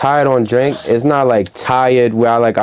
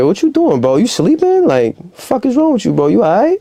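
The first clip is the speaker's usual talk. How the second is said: high, incredulous scolding of a sleeping friend; mock outrage; 10 semitones higher than usual. Catching a friend asleep, high mock outrage. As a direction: squeal it like you can't believe they're asleep. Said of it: "I be".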